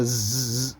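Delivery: ز as a long bare z buzz; skidding-car buzz. Shown as a demonstration. Teacher imitating a skidding car, ز buzzed long. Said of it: "گاڑی وغیرہ skid ہوتی ہے".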